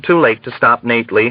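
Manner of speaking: plain reading voice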